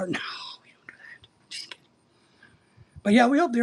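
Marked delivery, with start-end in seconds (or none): 0.0-1.8 soft conspiratorial whisper; a whispered just-kidding to the chat; whispered aside. Real, not acted. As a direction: whisper it like a secret joke. Joke aside after fundraiser quip.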